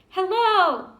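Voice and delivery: big loud call from a mountaintop; child shouting from the top to hear an echo; shouted hello. Retold or imitated. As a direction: call out high across the valley. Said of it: "I shouted".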